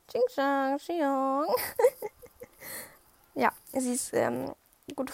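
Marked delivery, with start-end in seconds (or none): 0.1-1.5 a silly put-on voice in mock Chinese-sounding gibberish. Teacher mimicking her riding student's babble in a silly voice. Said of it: "sie war immer nur so". 1.5-2.8 a sudden high burst of laughter. Real, not acted. Vlogger laughing at her own silly imitation.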